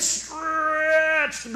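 "stretched" pulled out very long and high; drawn-out word. Shown as a demonstration. Preacher showing being stretched, "stretched" drawn way out. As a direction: stretch the vowel and sound of "stretched" out loudly.

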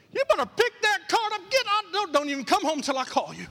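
hammed-up furious wife sending him out; angry wife. Preacher imitating a wife angry over a careless card, high mock tirade. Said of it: "What's she gonna say?".

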